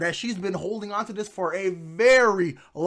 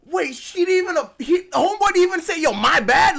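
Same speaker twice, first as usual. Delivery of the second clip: outraged; 7 semitones higher, 6 dB louder than usual. Real, not acted. Outraged at no apology.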